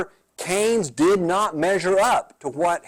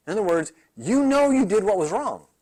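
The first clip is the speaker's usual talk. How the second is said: usual speaking voice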